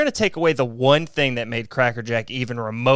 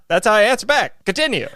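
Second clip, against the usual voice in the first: higher and louder announcer voice; old-timey radio voice; 8 semitones higher, 6 dB louder than usual. Pretend. Host vowing to keep the radio voice for the podcast, higher and louder. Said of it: "So that's my voice now".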